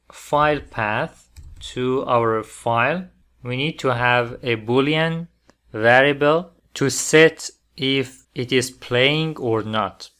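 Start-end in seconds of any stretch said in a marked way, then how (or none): none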